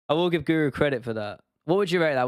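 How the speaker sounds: ordinary talk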